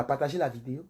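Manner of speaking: usual speaking voice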